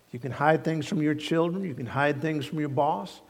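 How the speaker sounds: normal talking voice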